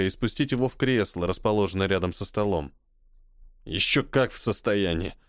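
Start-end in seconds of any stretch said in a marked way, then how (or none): 3.7-5.1 put-on drunk mumble. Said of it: "пробормотал маг".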